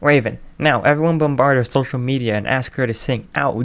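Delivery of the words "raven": plain reading voice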